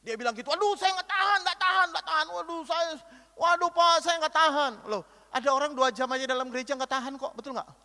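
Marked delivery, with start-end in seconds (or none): 0.5-4.9 exasperated groaning. Retold or imitated. Friend retold complaining about sermon.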